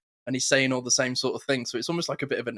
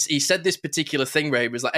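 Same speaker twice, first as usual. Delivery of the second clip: usual speaking voice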